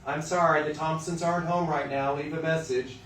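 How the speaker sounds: mock answering machine message; answering machine greeting. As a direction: read it like a formal phone greeting. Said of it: "make the recording like".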